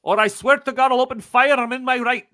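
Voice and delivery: high, loud threat; angry gunman. Acted. Gunman threatens to open fire, loud and angry. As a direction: snap the order with menace.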